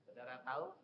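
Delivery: normal talking voice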